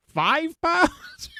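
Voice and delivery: very high-pitched disbelief; incredulous. Real, not acted. Host marveling at how much bacon was eaten, incredulous squeal. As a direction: squeal the amount in astonishment.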